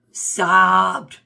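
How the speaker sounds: loud mock wail.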